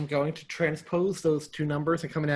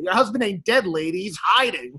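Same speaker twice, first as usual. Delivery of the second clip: movie quote; 4 semitones higher, 8 dB louder than usual. Retold or imitated. Quoting a gruff movie line.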